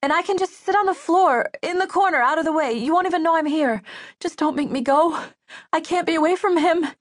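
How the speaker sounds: high, urgent begging; desperate pleading. A narrator's reading. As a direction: plead your case in a high voice. Girl pleads to stay with Lucas.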